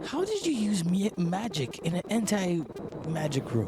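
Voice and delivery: princess voice. Acted. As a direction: play the snobbish princess looking down on him.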